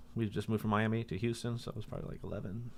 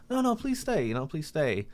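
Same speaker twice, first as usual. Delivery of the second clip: high, desperate pleading impression; retold tearful begging; 7 semitones higher than usual. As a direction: beg him to stay, mock-pitiful. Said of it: "he starts crying".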